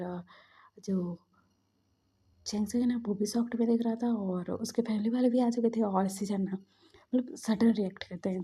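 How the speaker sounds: ordinary delivery, nothing marked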